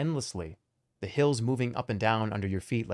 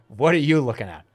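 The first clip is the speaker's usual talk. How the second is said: put-on louder old man voice, challenging; grumpy old man; 5 dB louder than usual. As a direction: growl it at the stranger. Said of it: "The old man's face curls up".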